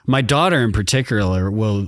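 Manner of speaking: ordinary talk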